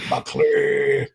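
low, slow mock-menacing Viking voice; teen pretending to be a Viking warrior, low and slow; fake deep voice. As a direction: boys putting on a deep growl to sound scary. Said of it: "they'd put a deep voice on".

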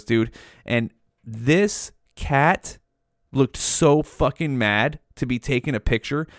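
usual speaking voice

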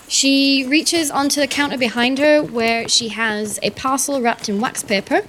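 normal talking voice

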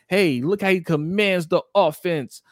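mock the fans' hype. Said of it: "They're trying to lead that".

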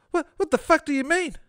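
high-pitched outraged little man; indignant shout. Acted. Little fat man shouts at Fred, high indignant voice. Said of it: "the little fat man shouted".

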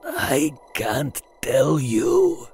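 slow pained whisper; injured pilot hisses, jaw clenched; hissed. Acted. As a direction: hiss it through the pain, very slow. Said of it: "he hissed".

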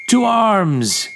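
loud, high battle cry; bellowing. A narrator's reading. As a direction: roar the call to arms. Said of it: "he bellowed".

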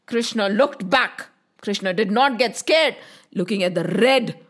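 usual speaking voice

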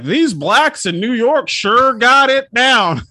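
loud, slow, high-swooping mock declaration; hammed-up tourist proclamation. Mocking a tourist bragging back home, loud hammed-up proclamation. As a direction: stretch it out and boom it like a clueless tourist. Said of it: "why are you talking like that?".

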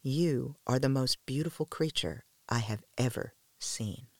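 soft, hushed tenderness into the ear; understated near-whisper. A narrator's reading. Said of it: "whispered into his ear".

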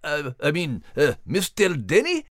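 lightly Scottish-tinged character, coaxing; playful wheedling voice. Acted. Max tries to butter up the announcer, coaxing.

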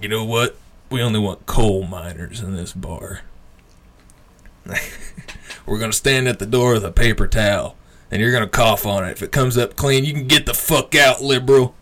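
lightly voiced drunk local, mock tough; a hint of a belligerent barfly. Retelling the drunk guy at the bar, mock tough.

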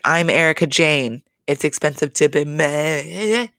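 mock diva catchphrase with a drawn-out ending; Erica Jane. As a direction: imitate a showy star, stretching the last word. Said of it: "her whole outfit just screamed".

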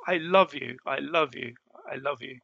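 usual speaking voice

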